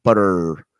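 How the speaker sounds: exaggerated American BUTTER with a long final r; American accent. Host imitating teacher showing how Americans say it, American accent. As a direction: draw out the r in Butter, American style. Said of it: "like, Americans would say it".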